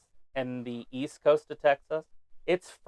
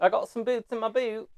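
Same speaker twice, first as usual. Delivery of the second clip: higher, playful put-on British accent; mock British accent; 7 semitones higher than usual. Friend poking fun at British words, mock British accent. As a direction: tease with a comic British voice. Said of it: "how you guys make fun of us".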